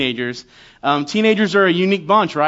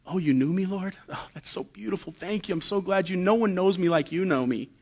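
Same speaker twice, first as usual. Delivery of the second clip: hushed, much softer, moved; almost breathe it, touched and grateful; awed Jeremiah; 8 dB quieter than usual. Acted. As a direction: Jeremiah melting at God's tender words, hushed. Jeremiah moved by God's words.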